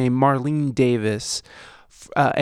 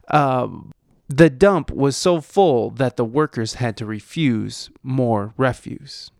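normal talking voice

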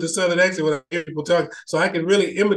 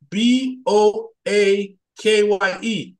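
usual speaking voice

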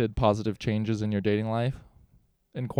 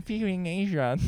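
higher-pitched, put-on Korean accent; 6 semitones higher, 3 dB quieter than usual. Host naming the podcast for the joke, mock Korean accent. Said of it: "In a Korean accent for some reason".

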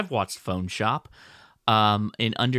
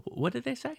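high, puzzled mock question, pitched up; Leia, baffled; 12 semitones higher, 6 dB quieter than usual. Mimicking Leia lost in a film's slang, pitched-up puzzled question. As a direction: play a confused viewer straining to follow. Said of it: "Leia would watch and go".

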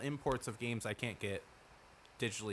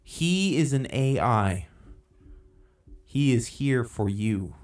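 loud slow proclaiming; 8 dB louder, slower than usual. Pretend.

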